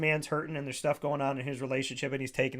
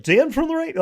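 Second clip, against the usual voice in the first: loud, higher, swooping announcer parody; mock radio voice; 12 semitones higher, 9 dB louder than usual. Protesting to girlfriend about radio voice, mock announcer delivery. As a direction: exaggerate it like a cheesy DJ, half laughing. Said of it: "don't talk like that".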